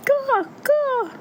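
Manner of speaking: very high bird cry; crow caw. A narrator's reading. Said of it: "said the crow".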